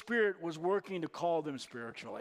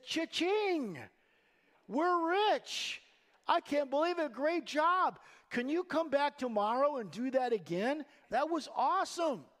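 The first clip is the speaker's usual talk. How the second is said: high, hammed-up glee over the catch; mock excitement; 6 semitones higher than usual. Peter mockingly cheers the huge catch, high and hammed-up. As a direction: play it as a gleeful jackpot win. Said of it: "Is that what he says?".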